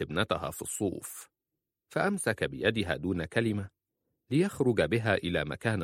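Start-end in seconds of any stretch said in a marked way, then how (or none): none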